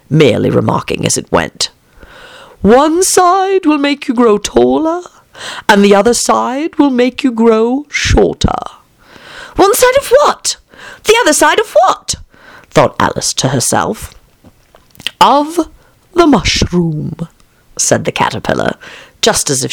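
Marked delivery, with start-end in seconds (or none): 2.6-8.6 high, slow, drawn-out caterpillar voice; languid caterpillar. A narrator's reading. 9.6-12.2 put-on very high, puzzled girlish questions; puzzled Alice. A narrator's reading. Alice wonders to herself. 15.2-17.3 slow, drawn-out caterpillar answer; languid caterpillar. A narrator's reading. Caterpillar answers Alice's thought.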